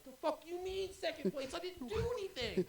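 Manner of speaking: loud, high indignant protest; protesting the game result; outraged high yell. Retold or imitated. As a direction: shout it in disbelief. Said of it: "I'm like".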